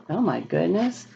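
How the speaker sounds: ordinary delivery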